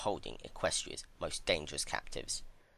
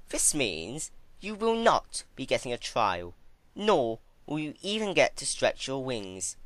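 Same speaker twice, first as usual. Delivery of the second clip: higher, louder, coldly smug announcement; smug villainess; 6 semitones higher, 4 dB louder than usual. Acted. Twilight informing Celestia she is a prisoner, smug and higher. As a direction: lay down the rules like a gloating jailer.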